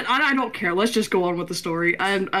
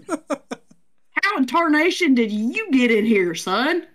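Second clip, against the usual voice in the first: slow, higher Southern drawl; Southern accent; 5 semitones higher, slower than usual. Acted. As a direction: play a folksy Southern yokel. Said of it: "We're all Southern".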